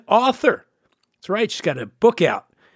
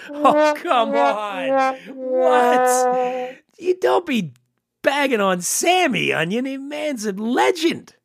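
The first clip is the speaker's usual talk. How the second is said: mock indignation; 7 semitones higher, much slower than usual. Real, not acted. Protests the joke about Sammy.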